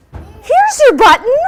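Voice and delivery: high, loud, slow happy cry; cried out. A narrator's reading. Frog finding the button, crying out loud and high. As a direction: call it out proudly. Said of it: "cried Frog".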